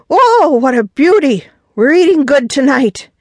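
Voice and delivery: high, loud, thrilled hollering; excited whooping. A narrator's reading. Old-timer cheers the netted shark, excited shout. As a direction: whoop with delight at the huge catch.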